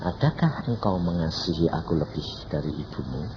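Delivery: low, soft question as God; God's voice. Retold or imitated. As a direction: ask quietly and low, as God. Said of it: "Tuhan bilang apa?". (suara Tuhan yang rendah dan lembut)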